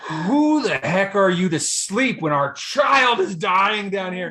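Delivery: mock-furious telling-off, loud and high; glaring outrage. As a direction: imitate an incensed wife, stretching each word. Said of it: "glaring at me like this".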